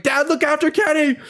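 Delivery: high urgent call to dad; urgent mom. Retold or imitated. Mom calling to dad as she runs, high and urgent. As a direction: snap into protective mother mode, voice jumping high. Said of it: "Mama Bear mode came out".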